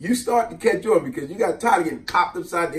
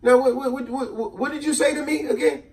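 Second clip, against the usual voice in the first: higher, louder stern aunt; aunt stopping him; 9 semitones higher, 5 dB louder than usual. Retold or imitated. Aunt stops the kid at the door, stern. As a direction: halt him with a stern hold-up. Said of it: "she said".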